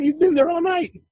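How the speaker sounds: high-pitched retold protest; defensive denial. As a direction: protest your dog's innocence to your uncle. Defending dog to uncle.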